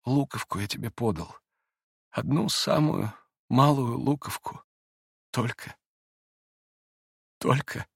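soft, halting, tender speech; hushed, tearful humility; close to tears. A narrator's reading. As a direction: let long pauses in, moved to tears. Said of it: "сам заплакал".

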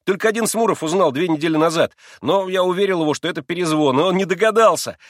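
high, loud, excited explaining; eager boasting. A narrator's reading. Boy showing off the trained dog, eager and excited. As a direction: tell it all proudly and urgently.

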